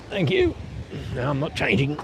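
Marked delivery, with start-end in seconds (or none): 0.1-0.5 very high, playful thank you; squeaky thanks. Real, not acted. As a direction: toss it off in a squeak. Playful thanks after rivalry.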